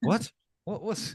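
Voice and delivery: high startled what; baffled, breathy what; surprised. Retold or imitated. Confused in wrong section.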